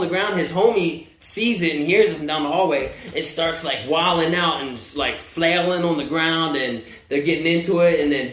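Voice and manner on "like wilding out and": ordinary talk, nothing marked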